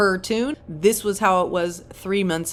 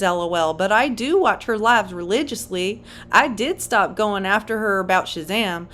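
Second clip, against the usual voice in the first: put-on Southern accent; 2 semitones higher than usual. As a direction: read it as a heavily Southern woman. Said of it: "I'm using a Southern accent for her".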